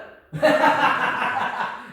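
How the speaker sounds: loud high burst of laughter; real laughter bursting out. Real, not acted. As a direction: crack up at your own story. Laughs at his cab story.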